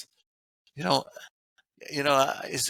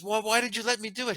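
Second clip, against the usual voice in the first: lightly acted, higher-pitched complaint; mock reproach; 7 semitones higher than usual. Wife to husband after it goes wrong, mock reproach. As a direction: imitate an accusing wife, pitch lifted.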